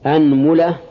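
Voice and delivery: "anmula", opening on a with u after the m; fatha, then damma. Shown as a demonstration. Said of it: "هذا في الفتح". (أنملة بفتح الهمزة وضم الميم)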